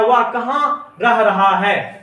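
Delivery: slow, drawn-out words, dictating; slow dictation. Shown as a demonstration. Teacher reading the third sentence to students, slowly. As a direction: read it out slowly so they can write it down. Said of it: "अब देखिए तीसरा sentence दिया है".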